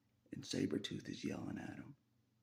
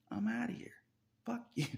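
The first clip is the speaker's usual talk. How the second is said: pitched way up, louder, mock-furious parting shot; retold angry outburst; 13 semitones higher, 4 dB louder than usual. Telling God off in a retold quote, high and loud. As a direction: fling it at God like a door slam.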